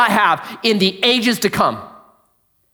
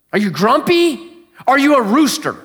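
ordinary delivery

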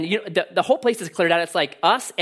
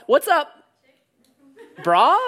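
higher, louder joking greeting to a woman; mock gal greeting; 8 semitones higher, 6 dB louder than usual. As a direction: try out a clumsy hello for a gal. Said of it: "It's much harder for gals".

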